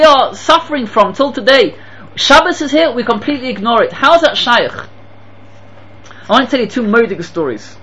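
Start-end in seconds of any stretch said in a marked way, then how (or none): none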